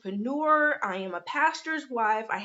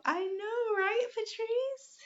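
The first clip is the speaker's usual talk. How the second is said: pitched way up and drawn out; playful high; 11 semitones higher, 4 dB quieter, slower than usual. Real, not acted. Answering Patrice's comment, high and slow playful. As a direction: tease a viewer in a high, stretched voice.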